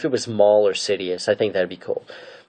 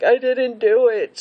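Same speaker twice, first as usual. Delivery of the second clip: high, drawn-out mock protest; protesting innocence; 14 semitones higher, slower than usual. Ahsoka answering her arrest, in a high mock protest. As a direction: plead innocence in a raised, put-on voice. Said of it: "Ahsoka's like".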